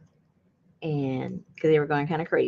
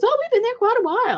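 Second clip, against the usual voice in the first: quick neighbor voice; 4 dB louder, faster than usual. Retold or imitated. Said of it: "He goes".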